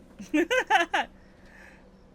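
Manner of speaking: high, loud real laugh; loud burst of laughter. Real, not acted. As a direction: crack up at your own joke. Laughing at own French joke.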